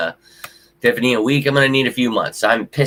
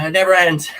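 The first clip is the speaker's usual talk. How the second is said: it never ends, a touch higher, with less force; calmer repeat; 7 semitones higher, 4 dB louder than usual. Real, not acted. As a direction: say it never ends again, resigned. Repeating the phrase, rising then settling.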